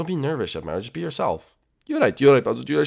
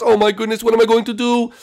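loud, raised-pitch panic; loud fretting; 9 semitones higher, 6 dB louder than usual. Acted. Chef dreading the unexpected date, loud panic. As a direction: fret out loud in alarm.

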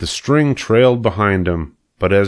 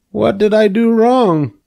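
high, loud pig wail; bewildered pig; 5 dB louder than usual. A narrator's reading. Pig asks after his bump, bewildered. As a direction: cry it out as a baffled pig.

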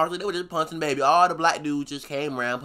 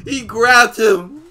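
stunned; 4 semitones higher, 9 dB louder, much slower than usual. Real, not acted. Reacting to the video.

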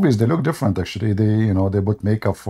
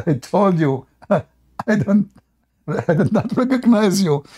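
laughing; 7 semitones higher than usual. Retold or imitated. Retold, laughing, higher.

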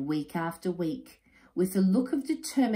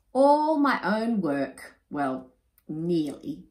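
slow, louder boast then sheepish nearly; proud boast; 6 dB louder, slower than usual. Acted. Father boasting to his friends, slow and proud. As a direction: show them off. Enoch shows off chicks.

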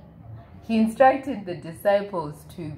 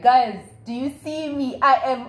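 higher, louder showing off to friends; boastful show-off; 6 semitones higher, 6 dB louder than usual. Retold or imitated. Peter calling to his friends from the water, higher and louder in boast. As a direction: look at me, I'm doing it!.

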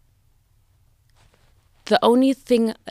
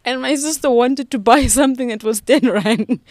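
louder and higher, laughing through the story; laughing retell; 6 semitones higher, 8 dB louder than usual. Real, not acted. Telling the podcast host a funny memory of her late aunt, laughing. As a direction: giggle as you recall the aunt's joke.

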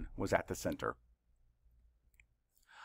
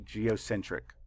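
ordinary delivery

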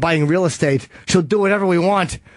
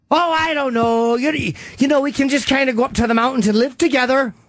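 put-on wife voice; 6 semitones higher than usual. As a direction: play the wife airily floating a plan. Acts out the wife's proposal.

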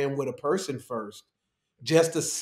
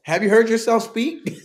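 incredulous; 8 semitones higher, 6 dB louder than usual. Retold or imitated. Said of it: "I'm like".

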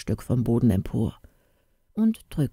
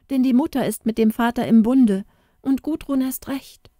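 put-on high, urgent girl's voice, faster in the middle; distressed young girl; 7 semitones higher than usual. A narrator's reading. Inga telling Andri her father wants her wed to Lars. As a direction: pour out the bad news in distress.

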